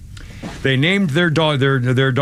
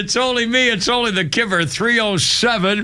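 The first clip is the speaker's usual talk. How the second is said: radio host intro; 6 semitones higher than usual. Acted. Restarting show intro, take two.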